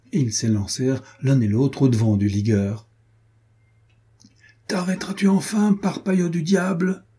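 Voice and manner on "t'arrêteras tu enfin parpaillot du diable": high, called-out gruff hail; breathless shout. Acted. Renaud chasing his friend on horseback, shouting breathlessly. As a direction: yell after him while riding hard. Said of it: "cria Renaud hors d'haleine".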